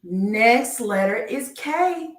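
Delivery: slow sing-song reveal of the next letter; drawn-out announcement. Acted. Slow game-show style letter reveal.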